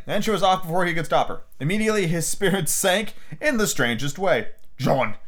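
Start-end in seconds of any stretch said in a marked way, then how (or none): none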